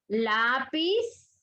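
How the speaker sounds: "lápiz" with a long stressed "lá"; stress on penultimate syllable. Shown as a demonstration. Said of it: "En la penúltima sílaba".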